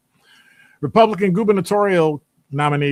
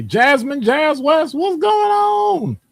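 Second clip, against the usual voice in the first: long, stretched, very high call of welcome; hyped shout-out to a regular viewer; big excited greeting; 5 dB louder, much slower than usual. Real, not acted. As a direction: sing it out loud to a friend who just showed up. Calling out greeting to listener.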